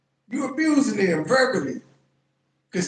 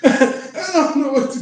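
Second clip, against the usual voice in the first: laughing in disbelief; 8 semitones higher, 7 dB louder than usual. Real, not acted. Reacting to absurd clip.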